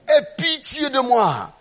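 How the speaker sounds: high, drawn-out retold plea. Preacher repeats the blind man's plea to Jesus, crying out. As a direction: stretch out an insistent cry for mercy. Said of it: "il cria plus fort".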